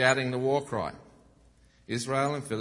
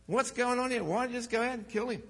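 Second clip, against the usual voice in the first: high and fast, blurting it out; an innocent boy who doesn't see the problem; eager naive teen; 12 semitones higher than usual. Retold or imitated. Teen David asking the soldiers why nobody fights.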